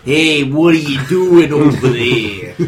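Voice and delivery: slow, lightly put-on Boston accent; attempted Boston accent. Visiting singer tries out the local speech, mock Boston accent. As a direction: have a go at Boston, stretched out. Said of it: "try to do a Boston accent".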